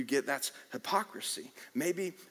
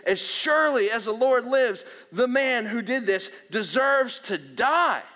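angry verdict; 8 semitones higher, 9 dB louder than usual. Retold or imitated.

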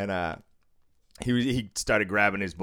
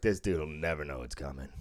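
quiet scheming thought; 8 semitones lower, 6 dB quieter than usual. Retold or imitated. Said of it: "I was like".

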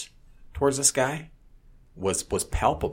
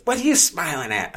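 higher-pitched, indignant challenge; mock confrontational demand; imitated angry woman; 9 semitones higher, 4 dB louder than usual. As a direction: mimic her snapping at a bystander. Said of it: "she looks at me and she goes".

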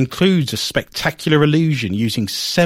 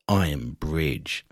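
deep, slow horror-trailer voice; spooky; 12 semitones lower, 8 dB quieter, much slower than usual. Pretend. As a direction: drop low and drag it out. Mock-spooky reading of "sinister.

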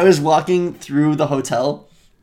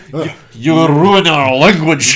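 slow, louder fake Russian accent with a laugh; mock Russian-ish accent; 7 dB louder, much slower than usual. Redoing the French line in a fake Russian accent. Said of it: "That sounded Russian".

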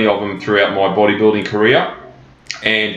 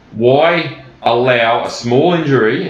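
"small" drawn out on a slow rise; stress on small; 3 semitones higher, slower than usual. Shown as a demonstration. Coach to clients, downplaying the injury: stress on "small". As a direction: lean on "small", slow the whole line. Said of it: "and I emphasize the word small".

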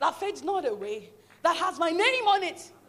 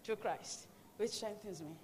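softer, lower muttered reminder; quiet self-talk; 6 semitones lower, 15 dB quieter than usual. Retold or imitated. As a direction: quietly encourage yourself.

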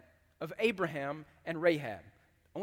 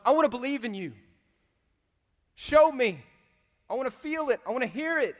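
higher, louder pleading demand; 5 semitones higher, 7 dB louder than usual. Retold or imitated. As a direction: urge him, wanting to believe. Voicing the client's demand.

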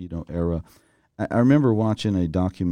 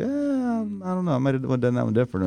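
mock hesitant musing; 6 semitones higher than usual. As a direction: shrug it off in a pretend voice. Host voices columnist's second thoughts.

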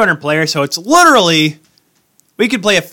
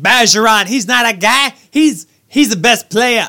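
put-on a failed try at a Boston accent; half-hearted Boston accent; 6 semitones higher than usual. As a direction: push a Boston accent on Bergeron, slightly slower. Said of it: "I can't do my Boston accent".

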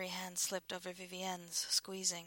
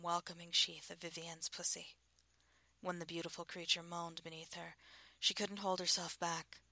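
ordinary delivery

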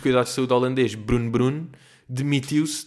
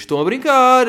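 high, loud scolding; exasperated shout; 12 semitones higher, 10 dB louder than usual. Real, not acted. Telling listeners off for going out, loud scold. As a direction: scold them for joking around. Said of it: "eu vou-vos dizer mais uma vez".

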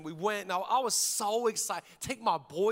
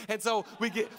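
usual speaking voice

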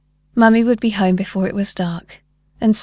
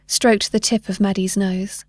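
plain reading voice

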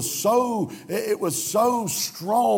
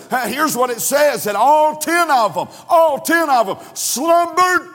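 higher and louder, shouting the build; fiery preaching; 7 semitones higher, 5 dB louder, slower than usual. Acted. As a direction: preach it out over the congregation. Preaching at full force.

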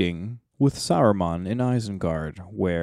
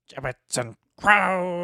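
hammy stage actor; 9 semitones higher, slower than usual. Retold or imitated. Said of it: "harshly declined".

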